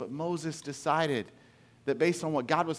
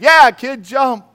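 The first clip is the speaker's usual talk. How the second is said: high, loud, cheerful permission; loud go-ahead; 8 semitones higher, 16 dB louder, slower than usual. Retold or imitated. Dad cheering his son on from the yard. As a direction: egg the kid on over his wife's panic. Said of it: "looked past his wife and said".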